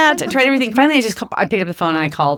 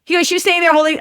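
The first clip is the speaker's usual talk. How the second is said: much higher, teasing voice of the hotel guy; amused hotel guy; 10 semitones higher than usual. Retold or imitated. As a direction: tattle on her with glee. Hotel guy tells coworkers story.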